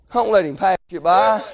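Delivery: ordinary talk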